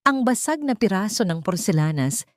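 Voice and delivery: plain reading voice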